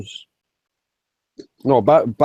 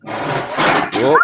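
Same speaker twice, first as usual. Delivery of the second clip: normal talking voice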